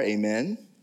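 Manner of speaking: ordinary talk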